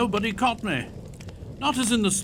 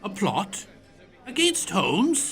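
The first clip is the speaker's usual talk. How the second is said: surprised; 3 semitones higher, slower than usual. Acted. Watson shocked at plot.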